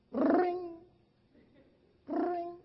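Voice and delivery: high, held trilling ring; mimicked phone ring. Preacher telling how mama phoned him, mimicked phone ring. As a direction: imitate an old telephone ringing.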